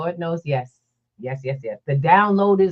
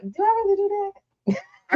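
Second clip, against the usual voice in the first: much higher, whining mock disbelief; mock whiny disbelief; 14 semitones higher, faster than usual. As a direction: squeak it out like you can't believe it's you. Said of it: "I'm like".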